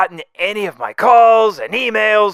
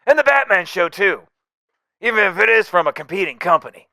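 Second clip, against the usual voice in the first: normal talking voice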